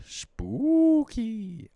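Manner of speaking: slow, high spooky drawl. Pretend. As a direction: say it ghost-story spooky. Spooky movie.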